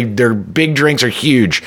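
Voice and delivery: normal talking voice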